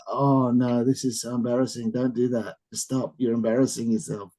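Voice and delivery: low, hushed mock-mortified mumbling; playful soft impression of someone cringing; imitating embarrassment. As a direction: voice an embarrassed onlooker under your breath. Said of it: "Ayano looks so embarrassed. She's like".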